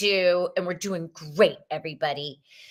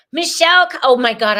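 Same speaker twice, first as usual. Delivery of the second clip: high, loud delighted call-out; loud, high and overjoyed; excited greeting; 5 semitones higher, 10 dB louder, faster than usual. Real, not acted. Greeting a viewer by name, delighted.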